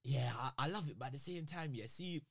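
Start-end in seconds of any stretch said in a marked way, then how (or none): none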